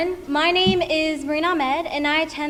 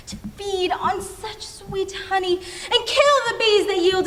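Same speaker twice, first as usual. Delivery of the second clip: slow, theatrical lament with higher-pitched outbursts; anguished Shakespearean soliloquy, long dramatic pauses; sad, frustrated Julia; 3 semitones higher, slower than usual. Acted. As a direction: rail at yourself in drawn-out stage speech. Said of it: "Julia is sad and frustrated at herself".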